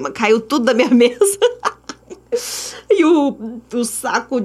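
laughing mid-sentence. Real, not acted. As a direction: laugh through the last word. Things fell off her desk.

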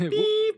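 high-pitched beep sound; censor beep. Acted.

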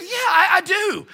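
high, loud, drawn-out protest; stubborn young man arguing back; insistent. Retold or imitated. Acted insisting back to pastor.

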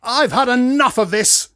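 loud, high outburst; exploding in anger. A narrator's reading. Dad fed up with the argument, exploding in anger. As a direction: burst out, patience gone. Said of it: "exploded Dad".

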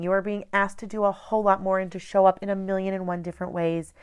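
ordinary talk

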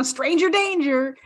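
ordinary talk

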